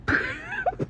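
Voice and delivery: a short, high-pitched real giggle. Real, not acted. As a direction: giggle as the memory hits you. Laughing while telling the story.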